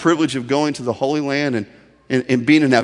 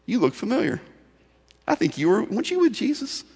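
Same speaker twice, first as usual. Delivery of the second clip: put-on slave girl; 7 semitones higher, 3 dB quieter than usual. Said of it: "this slave girl says".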